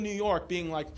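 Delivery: normal talking voice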